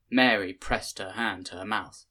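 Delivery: plain reading voice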